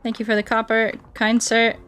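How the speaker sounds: ordinary delivery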